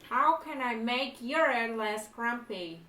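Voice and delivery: slow, loud, deliberate enunciation; careful dictation. Real, not acted. Player feeding a sentence to translation app, slow and clear. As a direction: speak up slowly for a machine.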